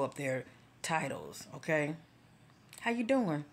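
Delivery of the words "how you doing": high-pitched "how you doing"; acted greeting. Asking someone who throws up titles, high-pitched.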